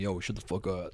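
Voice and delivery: low, hushed mock telling-off; quiet mock reprimand, pitched well down; imitated telling-off tone. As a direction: imitate a friend shushing people, low and soft. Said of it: "in that tone he usually does".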